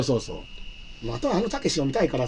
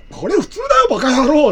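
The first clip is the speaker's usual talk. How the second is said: loud, rough Takeshi-style retort, pitched much higher; Takeshi impression; 9 semitones higher, 6 dB louder than usual. Father-in-law snapping at his son-in-law in a loud Takeshi voice. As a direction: bark it back like Beat Takeshi. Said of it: "たけしになっちゃってね".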